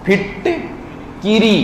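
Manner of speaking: फिटकिरी said very slowly, syllable by syllable; slow teacher demo of फिटकिरी; careful pronunciation. Shown as a demonstration. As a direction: spell out फिटकिरी slowly with a clear short कि. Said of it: "फिटकरी नहीं, फिटकिरी".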